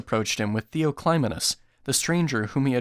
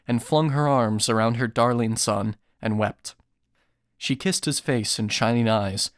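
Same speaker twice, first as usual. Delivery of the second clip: plain reading voice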